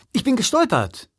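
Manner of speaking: high-pitched, defensive excuse; high, wronged self-defence; indignant protest. A narrator's reading. Hero protests his innocence.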